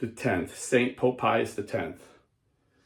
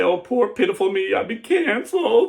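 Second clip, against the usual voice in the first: much higher, louder whiny mock wail; mock self-pity; 10 semitones higher, 7 dB louder than usual. Priest mocking a cancelled cleric's self-pity with a whiny, high mock wail. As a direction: sneer at the poor-me victim act.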